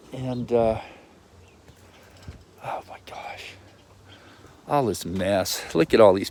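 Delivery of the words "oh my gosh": breathy whispered oh my gosh; whispered dismay. Real, not acted. Vlogger whispers at the mess in her yard. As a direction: whisper it in mild despair.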